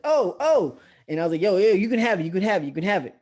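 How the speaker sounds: high, loud, hurried mock panic; panicked surrender. Storyteller re-enacts surrendering to a robber, panicked. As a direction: blurt it out, frantically giving in.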